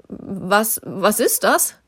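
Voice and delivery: faltering, half frightened question; unsure. A narrator's reading. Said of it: "fragte Susi unsicher".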